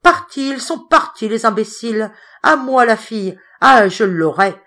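high, loud triumphant cry; gleeful shouting. A narrator's reading. The bear rejoicing alone, shouting with glee. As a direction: crow over the fools who left. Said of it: "Il criait".

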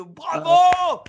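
very high, loud mock cheer; cheering bravo. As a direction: shout it like a whole crowd applauding. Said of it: "toute la salle qui applaudit".

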